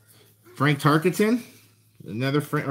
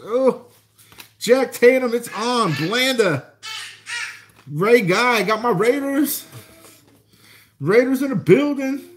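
high, giddy excitement over the cards; real freak-out, much higher pitch; can barely contain the hype; thrilled; 6 semitones higher than usual. Real, not acted. Finding Raiders cards one after another.

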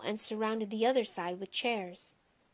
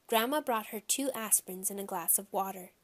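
plain reading voice

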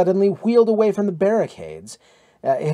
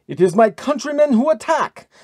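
high, loud, exultant cry; crowing with victory; triumphant cry; 9 semitones higher, 5 dB louder than usual. A narrator's reading. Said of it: "cried Victor Hasting triumphantly".

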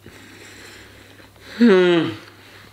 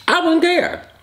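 dismissive. Real, not acted. Dismissive high squeak.

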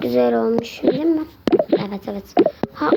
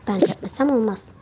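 ordinary talk, nothing marked

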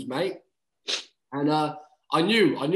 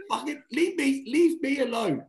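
mock irritation; 11 semitones higher than usual. Retelling irritation at coaches.

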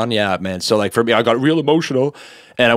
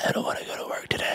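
secretive whisper, quicker and very soft; inner voice; 13 dB quieter, faster than usual. Retold or imitated. Inner me dreading work, reluctant whisper. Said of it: "my inner me".

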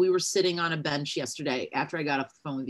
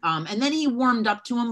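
ordinary talk

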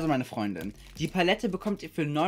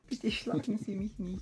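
mock pleading; 8 semitones higher, 3 dB quieter than usual. Mock pleading after threat.

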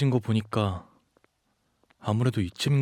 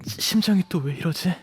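higher, faltering gasps between phrases; distressed, overheated; 5 semitones higher than usual. Acted. Runaway feeling body and heart change, gasping in distress. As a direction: struggle to get the words out, short of breath.

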